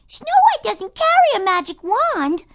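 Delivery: put-on high, loud child's call; a kid correcting her sister from across the room; little girl calling out. A narrator's reading. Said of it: "The first girl calls".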